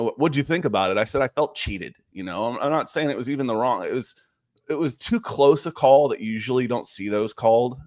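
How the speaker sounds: usual speaking voice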